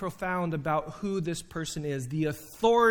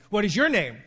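higher, louder, snapping the question back; ticked-off retort; 3 semitones higher, 6 dB louder than usual. Retold or imitated. Upset ref firing the question back at the pastor, mildly irritated. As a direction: throw the question right back at him. You can tell he's really upset and ticked off.

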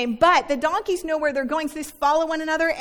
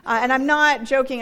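normal talking voice

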